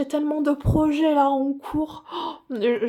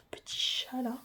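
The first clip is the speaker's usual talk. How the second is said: whisper; 11 dB quieter than usual. Real, not acted. Whispering to the cat picture.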